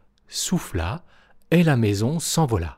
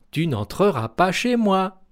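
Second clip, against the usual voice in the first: high squeaky no, rising to the last non; little pig refusing; 8 semitones higher than usual. A narrator's reading. The third pig refuses the wolf, high squeaky no.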